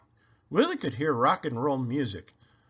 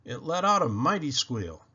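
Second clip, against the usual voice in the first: usual speaking voice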